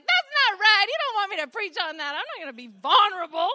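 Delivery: stubborn loud no to God; defiant loud retort. Retold or imitated. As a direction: refuse it out loud, half joking.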